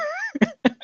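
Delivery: squealing laugh; real laughing fit; high burst of laughter. Real, not acted. Laughing at favorite scene.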